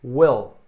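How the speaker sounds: Will said with a short clipped i like "wel". Shown as a demonstration. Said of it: "Repete comigo".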